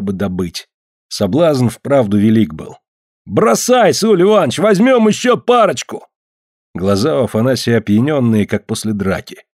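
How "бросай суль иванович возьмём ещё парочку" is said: excited, pitched-up urging; eager call. Acted. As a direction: call out greedily for more. Fisherman urges to keep fishing.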